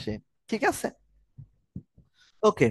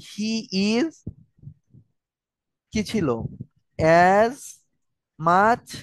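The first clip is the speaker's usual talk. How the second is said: dictating slowly; much slower than usual. Shown as a demonstration. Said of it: "দেখায় দিছি".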